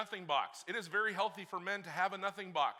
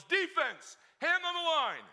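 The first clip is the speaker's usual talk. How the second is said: drawn-out bark, much higher and louder; coach yelling; 13 semitones higher, 8 dB louder, slower than usual. Retold or imitated. Coach calling the offense to the line, yelling. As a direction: call the team to the line like a coach. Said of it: "coach yells".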